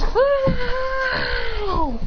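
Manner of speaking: long, high-pitched shouted cry; high howl. Acted. Fighter crying out in battle, long high howl.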